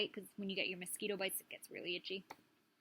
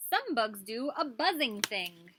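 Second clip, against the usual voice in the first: put-on reading to children; 6 dB louder than usual. Reading book aloud.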